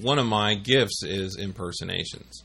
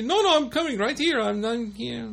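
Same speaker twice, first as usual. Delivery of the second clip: higher, fast put-on voice of a Filipino coworker; imitating Marciano; 13 semitones higher than usual. Prank caller faking coworker calling in late, high and quick. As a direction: rattle it off high and quick, playing the late worker. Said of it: "I could imitate him dead on".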